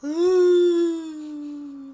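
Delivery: wolf howl. Pretend. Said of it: "that was not a wolf".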